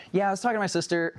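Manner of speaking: lightly voiced wife telling a story; imitating his wife. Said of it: "That's how my wife talks".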